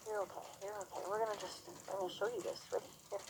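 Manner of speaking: hushed, gentle reassurance to a nervous horse; soft soothing murmur. Real, not acted. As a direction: murmur it quietly to calm him. Calming the horse.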